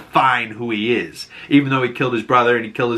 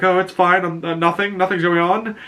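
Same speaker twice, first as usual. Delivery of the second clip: high-pitched imitated denial; mock flustered cover-up; 7 semitones higher than usual. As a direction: brush it off in a higher, hurried-innocent voice. Caught talking to himself, covers up.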